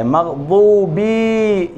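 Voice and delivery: मग़बूबी slowly, vowels wrongly lengthened; wrong reading. Shown as a demonstration. Teacher showing a common mistake. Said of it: "ये गलत है".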